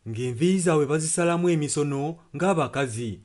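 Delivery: ordinary talk, nothing marked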